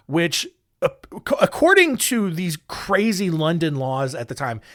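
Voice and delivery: ordinary delivery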